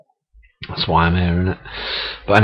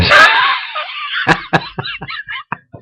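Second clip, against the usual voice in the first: loud, high burst of real laughter; laughing my head off; 4 dB louder than usual. Real, not acted. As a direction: let out a big helpless cackle after the prank. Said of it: "I was laughing my head off".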